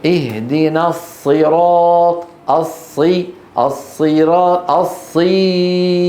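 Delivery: الصي held very long so a ya grows from it; correct kasra stretched. Shown as a demonstration. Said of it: "هل تولد يا؟". (الصي بكسرة ممدودة جدا)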